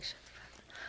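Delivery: breathy whispered "shut up", no voice at all; whispered retort. Retold or imitated. Brushing off her ex's "first love" line, whispered. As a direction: hiss it under your breath, teasing.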